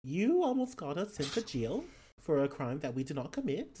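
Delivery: put-on Australian-accented scolding with swooping pitch; player's character, scolding. Character to companion after near arrest, scolding. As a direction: tell them off in a lilting Australian accent.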